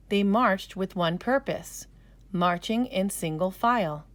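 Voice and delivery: usual speaking voice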